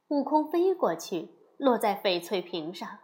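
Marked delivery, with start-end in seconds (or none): none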